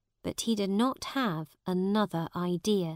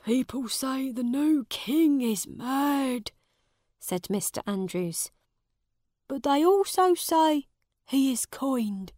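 put-on raised, anxious old servant voice; worried old servant; 6 semitones higher than usual. A narrator's reading. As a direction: tell it like a worried old servant sharing bad news.

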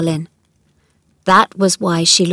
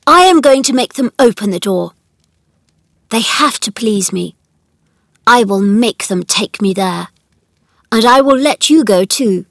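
put-on louder, insistent boy's voice; mildly excited; 3 semitones higher, 7 dB louder than usual. A narrator's reading. Colin vows they will open the door, mildly excited. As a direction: build a touch of excited determination. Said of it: "He had become quite excited".

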